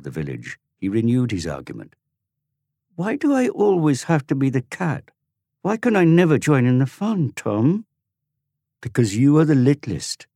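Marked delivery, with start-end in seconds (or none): 3.0-7.8 put-on high, louder child's voice, complaining; whining young boy. Dorian to his big brother Tom, high whining child. 8.8-10.3 put-on high, louder boy's voice, lordly; lordly authority. Tom to his little brother, lordly boyish authority. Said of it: "told him with lordly authority".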